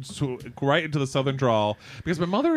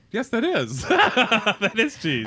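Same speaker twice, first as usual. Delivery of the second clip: higher-pitched, amused deadpan statement through laughter; laughing mock reply; 7 semitones higher, much slower than usual. Joking about someone declining cheese, laughing mock reply. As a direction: state the obvious while cracking up. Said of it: "they'll be like".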